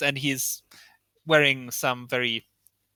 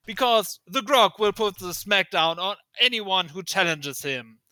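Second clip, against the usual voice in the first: raised-pitch wrestling promo; swaggering showman hype with punched phrases; boastful wrestler, hyped; 5 semitones higher than usual. Acted. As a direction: talk yourself up to the arena.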